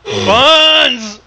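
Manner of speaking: loud, exasperated cry of puns; loud complaint about the puns; loud groan at puns. Real, not acted. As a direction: groan loudly at the pun.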